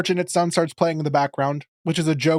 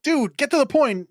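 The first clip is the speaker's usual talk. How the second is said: higher, mock impatient Amethyst; 9 semitones higher than usual. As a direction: hurry him along, pitched up. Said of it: "Amethyst eventually is like".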